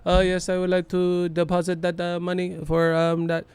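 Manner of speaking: put-on polite bank customer in a Pacquiao accent; Pacquiao impression. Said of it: "talking like Pacquiao at the bank".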